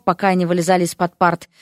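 plain reading voice

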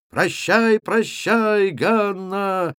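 high, ringing, drawn-out calls; shouted farewells. A narrator's reading. Several lads hanging on the supposed Ганна's neck, shouting. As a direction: holler goodbye over and over. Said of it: "закричало несколько парубков".

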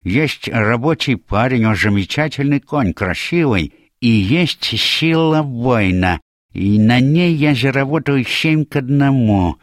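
high character voice with slurred sibilants; Atlas, eager bettor. Acted. Atlas weighing which horse to back, eager.

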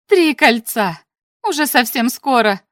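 exultant shout; triumphant cry. A narrator's reading. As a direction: cry out as if a hard battle is won. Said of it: "Победоносно вскричала она".

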